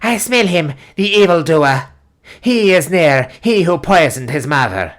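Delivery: high, loud witch shriek. Acted. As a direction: shriek it out in a frenzy. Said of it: "shrieking out sentences such as".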